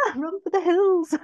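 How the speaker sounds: high, loud playful scream; mock terror. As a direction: shriek comically as if fleeing. Comic flight from responsibility.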